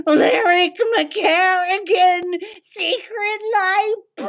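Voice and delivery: high, loud heroic cry; triumphant shout. Acted. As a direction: announce the hero triumphantly. Triumphant finale.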